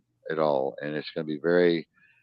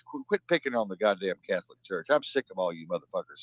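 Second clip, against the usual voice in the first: usual speaking voice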